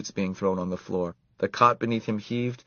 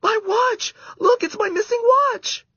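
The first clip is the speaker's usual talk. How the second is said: delighted shout; 6 dB louder than usual. A narrator's reading. Karen finds her watch.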